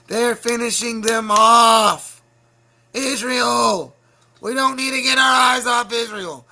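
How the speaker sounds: loud, slow furious yelling; angry shouting. Real, not acted. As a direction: shout it out in a rage. Angry rant about Israel.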